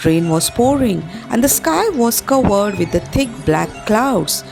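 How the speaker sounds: ordinary talk, nothing marked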